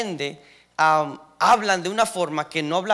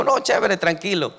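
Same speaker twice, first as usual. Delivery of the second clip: lightly raised, easy reassurance; reassuring; 5 semitones higher, 6 dB louder than usual. Retold or imitated. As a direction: brush it off kindly, a bit louder. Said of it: "Y yo le digo".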